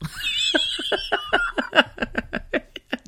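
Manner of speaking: squealing burst of laughter; high shrieking laugh. Real, not acted. Mocking her own old promises, shrieking laugh. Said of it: "a full-on giggling fit".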